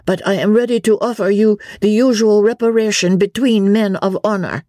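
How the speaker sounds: put-on high, earnest French-accented challenge; broken English, French accent. A narrator's reading. Young Vicomte offers Englishman a duel, French accent. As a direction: play a proud young Frenchman in halting English. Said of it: "speaking in broken English".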